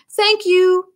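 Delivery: high, small fox voice; grateful fox. A narrator's reading. Fox thanks George for the sock in a small voice. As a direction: thank him in a little animal voice. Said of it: "said the fox".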